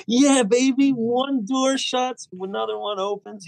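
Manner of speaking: high, thrilled cheer to himself; giddy high-pitched optimism; excited. Retold or imitated. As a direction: sing out the good news, stretched slow. Excited self-quote after audition call.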